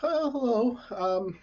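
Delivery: tentative researcher reporting bad findings; Faye. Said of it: "Faye is kind of just".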